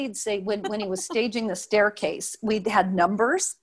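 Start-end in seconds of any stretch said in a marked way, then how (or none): none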